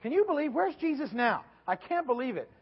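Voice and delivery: high, loud, exasperated griping; loud complaining. Retold or imitated. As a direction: moan loudly like tired rowers fed up with Jesus. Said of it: "I have an idea they were complaining".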